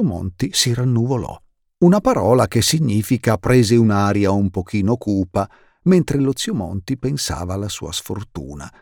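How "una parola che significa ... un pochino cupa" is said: usual speaking voice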